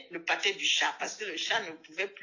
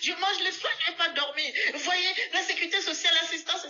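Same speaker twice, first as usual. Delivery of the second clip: put-on shouting old lady; 8 semitones higher than usual. Old woman shouts complaint.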